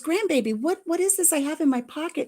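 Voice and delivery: high, playful mock wonder; feigned surprise. Grandpa to grandbaby about his pocket, playfully surprised. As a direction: tease the child with pretend amazement.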